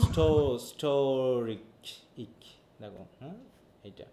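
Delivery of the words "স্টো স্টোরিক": স্টো held long, then স্টোরিক drawn out; stress on স্টো. Shown as a demonstration. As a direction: lean on স্টো in স্টোরিক, stretching it. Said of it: "second সিলেবলের উপর আছে". (স্টোরিক-এর স্টো টেনে জোর দিয়ে)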